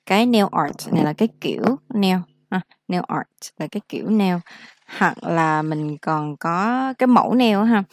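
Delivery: ordinary delivery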